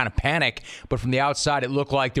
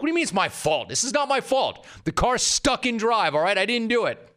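high indignant protest; retold protest; 9 semitones higher than usual. Teen tells his dad the stuck car isn't his fault, high protest. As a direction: plead your innocence, pitched way up.

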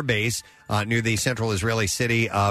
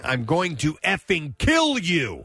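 slow, raised-pitch threat, mildly hammed-up; retold screamed threat; 5 semitones higher, slower than usual. Newsreader quotes daughter threatening her father, mock screaming. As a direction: lean hard into a mock threat. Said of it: "toward her father while screaming".

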